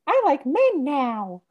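put-on high, slow, dopey kid voice; Ralph Wiggum voice. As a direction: say it like a clueless little boy, high and drawn out. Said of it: "he's like".

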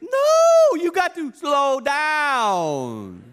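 dad shouting no. Retold or imitated. Dad refusing son, drawn-out shout. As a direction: hammer it home, drawn out. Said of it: "dad shouts".

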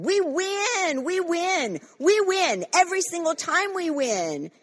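high, loud victory cry; ringing, emphatic preacher's shout; triumphant declaration. Real, not acted. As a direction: proclaim it like a winner. Building victory declaration against the devil.

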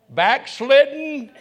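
slow, loud "Backslidden" stretched out; drawn-out answer. Acted. Preacher answering his own question to the crowd, stretched and loud. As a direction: drag out "Backslidden" as the punchline. Congregation call-and-response answer.

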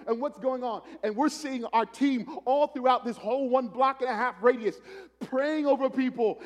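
high, loud revival preacher; full-force preaching. Acted. Preacher telling the congregation about street outreach, high and loud. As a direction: project the story to the whole church.

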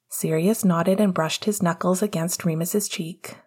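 ordinary delivery

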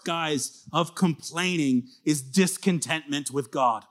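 ordinary talk, nothing marked

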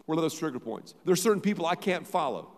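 normal talking voice

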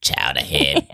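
mock New Englander calling someone Chowderhead; Boston accent. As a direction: mumble Chowderhead in a mild Boston put-on. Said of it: "New England".

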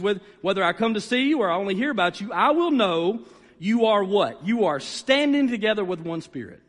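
usual speaking voice

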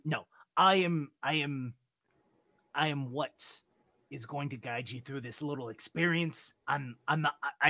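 ordinary talk, nothing marked

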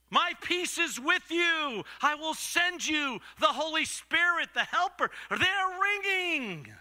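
high, drawn-out ringing proclamation; exalted pulpit voice; impassioned preaching. Acted. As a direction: preach it out, holding the last words long. Preaching at full force.

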